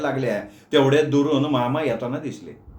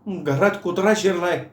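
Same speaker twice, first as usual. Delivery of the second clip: higher, blurted in one breath; 4 semitones higher than usual. Acted. Meenu blurts the news to uncle in one breath. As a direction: blurt it all at once. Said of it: "एका दमात मीनूनी सांगून टाकलं".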